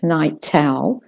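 "night owl" in British received pronunciation, with a crisp aspirated T and a full "owl", said slowly; a slow, careful RP "night owl", the T released clearly before the vowel. Shown as a demonstration. Teacher demonstrating the British RP version of "night owl", crisp T. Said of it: "if you're doing more towards the received pronunciation".

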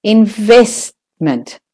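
"investment" with VEST held long; stress on VEST. Shown as a demonstration. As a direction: lean hard on the second syllable of "investment". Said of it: "the vest is the stressed syllable".

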